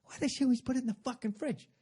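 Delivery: muttering to myself. Retold or imitated. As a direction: grumble it under your breath, quick and high. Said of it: "start muttering to myself".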